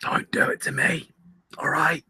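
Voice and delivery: breathy whispered plea; whispering. Real, not acted. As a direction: whisper it like a desperate fan.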